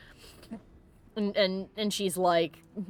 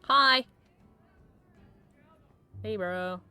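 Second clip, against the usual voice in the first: usual speaking voice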